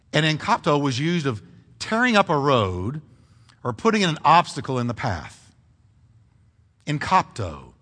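ordinary delivery

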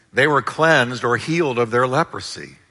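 usual speaking voice

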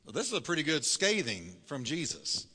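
usual speaking voice

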